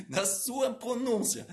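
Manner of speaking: lento e mais agudo, articulando cada sílaba; pronúncia exagerada. Shown as a demonstration. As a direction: estique "sua pronúncia" com articulação caprichada. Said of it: "quanto mais você exagerar". (devagar, com articulação exagerada)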